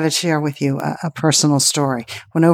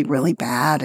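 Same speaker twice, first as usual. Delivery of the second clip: ordinary talk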